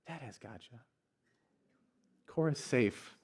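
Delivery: soft, hushed self-soothing; imitating a toddler's whisper. Toddler soothing herself in her father's arms, whispered. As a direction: barely breathe it like a calmed child. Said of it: "I hear her whisper to herself".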